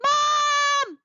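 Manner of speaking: high loud call for Mom. Acted. Eddie calling Mom for help, high loud call. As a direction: call her from upstairs. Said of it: "Eddie called for Mom".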